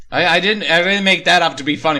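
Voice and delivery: ordinary talk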